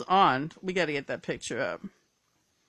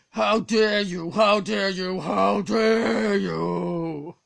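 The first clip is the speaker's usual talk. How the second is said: loud, higher, drawn-out outraged scream; screaming brother impression; 5 semitones higher, 10 dB louder, slower than usual. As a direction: scream it slowly in outrage, stretching the last DARE. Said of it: "he screamed this in my face".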